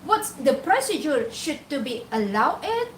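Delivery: normal talking voice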